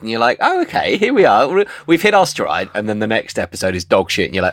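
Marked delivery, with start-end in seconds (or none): 0.4-2.7 high-pitched burst of mock optimism; pleasantly surprised. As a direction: perk up as a hopeful viewer, pitched high. Acting viewer pleased by good episode.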